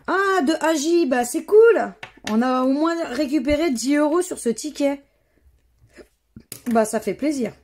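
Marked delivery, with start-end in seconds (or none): 0.1-5.0 pleased, raised voice at a small win; real delight over the ticket; excited. Real, not acted. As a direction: cheer up at the win. Happy about a small win.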